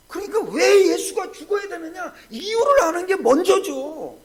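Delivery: loud, crying preacher's call; preaching shout. Real, not acted. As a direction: cry it out to the congregation. (목소리를 높여 외치는 설교)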